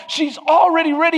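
ordinary delivery

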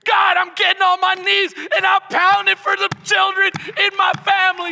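loud, high, fervent shouted plea; pounding prayer. Acted. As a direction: cry out to God, refusing to give up. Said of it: "pounding on the gates of heaven".

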